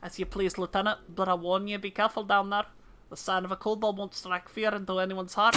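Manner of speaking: put-on Scottish officer's voice; fake Scottish accent. Commander warning the lieutenant about kobolds, fake Scottish accent. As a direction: play a Scottish commander giving a caution. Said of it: "in my accent".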